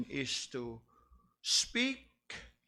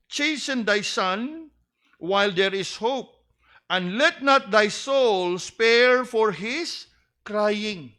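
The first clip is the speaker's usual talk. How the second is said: much louder and higher, the verse slowed and hammered; full-force preaching; 9 semitones higher, 9 dB louder than usual. Acted. Preacher thundering the Proverbs verse at the fathers, loud. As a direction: thunder the scripture at the congregation.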